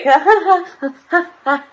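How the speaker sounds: mock high, awkward giggle; awkward laugh. Showing how Heather laughed turning sideways, awkward fake laugh. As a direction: imitate her forced laugh, pitched well above your voice. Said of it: "doing this really awkward laugh".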